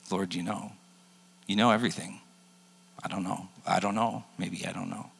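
soft, wavering uncertainty; upset Peter, quiet. Retold or imitated. Peter answering Jesus, quietly unsure. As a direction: trail off, lost for words.

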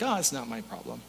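low, soft, offhand dismissal; mock indifference. Bystander ignoring a child running into the street, mock indifferent. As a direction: shrug it off as an unbothered bystander.

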